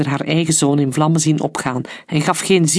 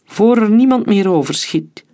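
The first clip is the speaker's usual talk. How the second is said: higher, louder rallying call; urgent command; 6 semitones higher, 4 dB louder than usual. Acted. Leader to his mercenaries, urgent louder command. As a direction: push the men to act.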